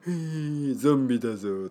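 playful zombie growl; lightly voiced zombie, teasing kids. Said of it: "とか言いながら".

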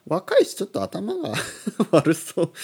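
breaking into a laugh mid-remark; high, laughing through the words. Real, not acted. Host teasing the Terrace House cast, laughing.

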